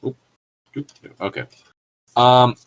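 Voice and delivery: ordinary talk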